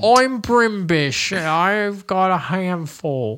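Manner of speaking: slow, high, hammed-up British accent; mock British accent. Cohost jokes in a bad British accent. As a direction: play a silly British gent, drawn out and high. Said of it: "Is that a British accent?".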